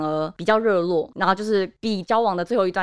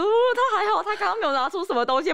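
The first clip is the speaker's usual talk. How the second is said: relieved laughing thought; 11 semitones higher than usual. Retold or imitated.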